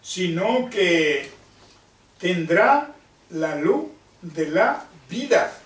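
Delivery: slow, deliberate scripture reading with long pauses; lectura bíblica solemne. Acted. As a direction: read the verse out phrase by phrase, very slowly. Lee el versículo en voz alta. (lectura bíblica lenta y pausada)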